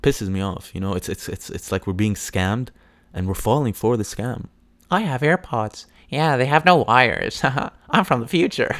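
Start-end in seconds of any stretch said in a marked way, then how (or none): 4.9-8.8 hammed-up boastful voice, higher, laughing through it; smug AirPods owner. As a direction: brag about your wireless buds like a show-off.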